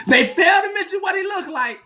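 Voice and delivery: words breaking into high laughter; laughing through it. Real, not acted. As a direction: laugh while you push the point out. Laughing while making point.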